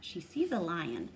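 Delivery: usual speaking voice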